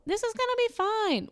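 high-pitched mock self-reassurance; imitating forced optimism; self-convincing. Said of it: "tried to convince myself".

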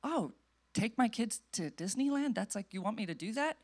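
soft, hushed mock wondering; tentative realization. Believer asking God about a pictured idea, softly puzzled. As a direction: murmur it as a dawning question to God. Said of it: "and be like".

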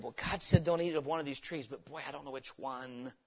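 a lightly voiced baffled Adam, trailing soft; mock confused Adam. Preacher mocks a confused Adam, softly baffled. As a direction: play Adam scratching his head. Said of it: "He sat and said".

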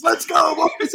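high, pumped-up locker room yell; hockey voice. Shown as a demonstration. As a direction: fire up the team before the game. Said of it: "I just start talking like this".

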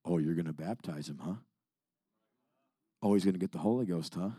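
low, soft, sneering devil voice; mocking devil. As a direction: taunt him quietly like the enemy. Devil taunting the preacher.

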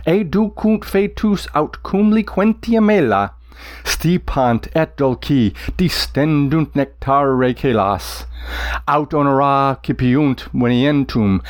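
slow Latin verse with meter stressed; metrical recitation. Shown as a demonstration. Teacher reciting Virgil for students, exaggerated meter. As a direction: recite it, leaning on each beat. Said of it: "exaggerated the individual lines slightly to highlight the meter".

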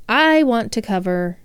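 ordinary delivery

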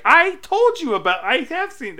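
high, loud squeaky diva voice; Miss Piggy voice. Retold or imitated. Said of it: "sorry for the Miss Piggy voice".

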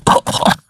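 acted cough of a little creature; imitated cough. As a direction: cough it out in character. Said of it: "He's got, like, a cough".

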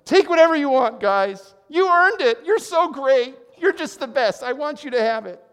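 shower your men with praise like a thrilled king; giddy victory cheer. Retold or imitated. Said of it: "in the thrill of victory".

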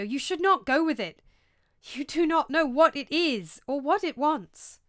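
high, insistent caution; urgent warning. Acted. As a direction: warn a friend off, urgently. Horatio warns Hamlet not to follow.

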